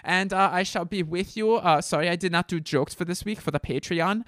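comic put-on German accent. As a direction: sign off in a joking German accent. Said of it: "I apologize for the German accent".

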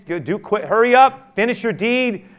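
rising to a loud urgent shout; building from firm to shouted; urgent command. Retold or imitated. Preacher voices Jesus urging Judas.

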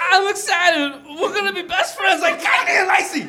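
high, overexcited goofball squeal; giddy high voice. Acted. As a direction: gush like a giddy kid. Zed gushes about partnership.

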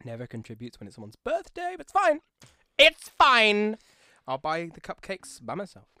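passive-aggressive. Pretend. Passive-aggressive coworker complaint.

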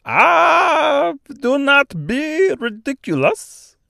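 high, loud, drawn-out Austrian-French exclamation; Austrian-French accent. A narrator's reading. Large bird dismissing Dorothy's refusal, high Austrian-French accent. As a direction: wave off the worry in a thick mock Austrian-French accent. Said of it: "in a strangely thick, almost Austrian accent".

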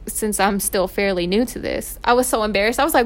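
normal talking voice

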